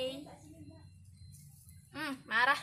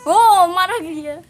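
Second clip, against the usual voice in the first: loud slow exclaim; 3 semitones higher, 14 dB louder, slower than usual. Real, not acted. Exclaiming the cat is angry.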